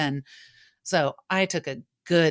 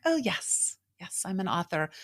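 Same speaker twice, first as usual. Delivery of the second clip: hollow self-reply; 4 semitones higher, 6 dB quieter than usual. Retold or imitated. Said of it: "And I'd be like".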